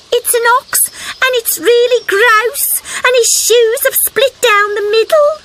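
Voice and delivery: put-on high, breathless kid-frog telling of a monster; a small frog wide-eyed with news; little frog voice. A narrator's reading.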